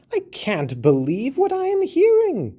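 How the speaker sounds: a tiny mouse shocked and protesting; high mouse voice crying out in disbelief. A narrator's reading. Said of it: "the mouse cried out".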